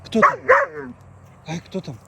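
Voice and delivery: high excited "who's there"; talking to a dog. Real, not acted. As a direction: rouse the dog, rising pitch. Asking dog who is there.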